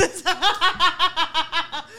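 bursting into a high-pitched laugh; cracking up at her own joke. Real, not acted. Laughing at her friend's star sign, high burst of laughter.

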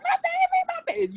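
very high, cooing mom voice; proud mom. Retold or imitated. Mom hearing her child's big news, proud high coo. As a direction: coo it like a mother bursting with pride. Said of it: "And she was like".

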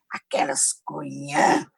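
slow, low, drawn-out put-down; pejorativo. Shown as a demonstration. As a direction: stretch CUNHÃ out low and scornful. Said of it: "Aí é fuleiragem". (deboche arrastado, em voz baixa)

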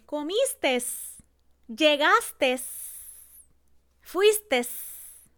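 comistes, llegastes, fuistes with an extra final s; added final s. Shown as a demonstration. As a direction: con s final añadida. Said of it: "pronuncian S al final".

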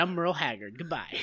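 a hint of a low country narrator. Narrator bidding farewell, lightly voiced low sign-off. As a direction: sign off low and laid-back. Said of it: "signing off as the narrator".

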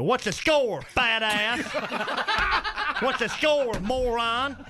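hammed-up uncle yelling, drawn out; shouting at radio. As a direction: heckle the radio like a cranky fan. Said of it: "he just shouts at the radio".

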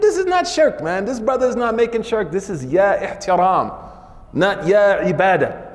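high, firm denial; sheikh objecting. Retold or imitated. Sheikh insisting it is not shirk, firm and high. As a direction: cut the charge down, high.

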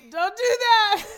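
high, loud wail through laughter; real tearful protest. Real, not acted. Reacting to a friend's touching question, crying protest. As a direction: beg them to stop as the tears hit.

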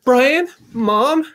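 high, loud joyful calling; overjoyed mother calling out; excited high reunion. Acted. Mother reunites with lost son.

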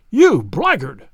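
loud, furious accusation; enraged shout. A narrator's reading. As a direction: hurl it out in narrated rage. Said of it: "I shouted, beside myself with rage".

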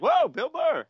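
high delighted whoa; surprised fan spotting Bill Burr; excited surprise. Retold or imitated.

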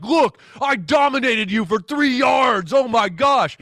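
lightly voiced cocky defender crowing over a tackle; mock taunting player.

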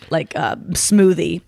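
normal talking voice

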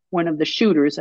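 ordinary delivery, nothing marked

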